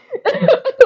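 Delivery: sudden high laugh; real laugh cutting in; burst of laughter. Real, not acted. Laughing at her own story.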